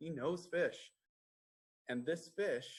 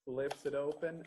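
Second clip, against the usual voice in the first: plain reading voice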